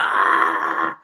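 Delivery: loud, low, drawn-out "ah"; Bronx-style yell. Retold or imitated. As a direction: let out a long loud Bronx holler. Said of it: "I went Bronx".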